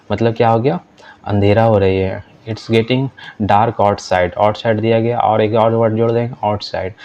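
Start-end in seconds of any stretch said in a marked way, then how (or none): 2.5-4.4 slow, careful classroom English; deliberate teacher read-aloud; slow model reading. Shown as a demonstration. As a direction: spell each word out slowly for learners. Teacher reads model sentence.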